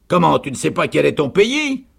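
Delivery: loud question soaring at the end; incredulous sergeant. A narrator's reading. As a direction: exclaim it in high disbelief. Sergeant astonished by her ignorance. (sergent incrédule, question forte et montante)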